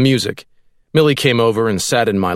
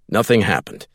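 ordinary delivery, nothing marked

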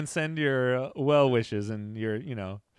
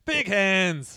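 high, loud bad Australian "Big hands"; a loud, high, bad Aussie accent; mock Australian accent; 8 semitones higher, 6 dB louder than usual. Mocking a friend's teasing in a loud, bad Australian accent. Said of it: "I got the worst accents".

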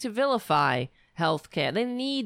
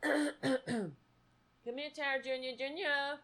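high, soft coaxing call; cat-calling voice; 7 semitones higher, 8 dB quieter than usual. Real, not acted. Owner coaxing her cat, high and soft. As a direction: sweet-talk the cat over.

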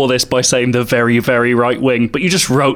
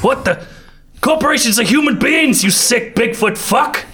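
higher, indignant shouted insult; outraged shout; 9 semitones higher than usual. Acted. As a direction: yell it in shocked outrage. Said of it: "be Denver".

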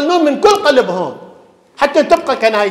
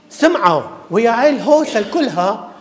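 normal talking voice

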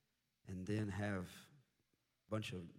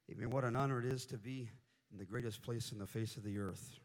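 usual speaking voice

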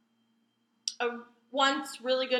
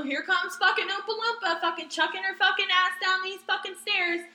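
ordinary delivery

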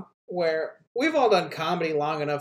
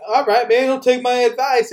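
huffy retort, higher and louder; butthurt; 5 semitones higher, 5 dB louder than usual. Retold or imitated. Comic retells a rejected advice-giver's retort, mock butthurt. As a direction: imitate a comic taking offence. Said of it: "he got, like, really butthurt".

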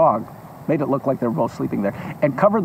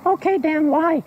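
high mock question; 12 semitones higher than usual. Shown as a demonstration. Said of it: "you wanna know why".

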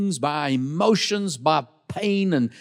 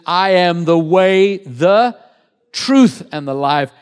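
preached scripture; 8 dB louder, slower than usual. Acted. Quoting Jesus from the pulpit.

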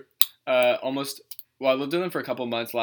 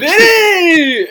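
loud, high, cheering a name; shouting a name; 14 dB louder than usual. Pretend. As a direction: call out like a party greeting. Greeting Vinny arriving.

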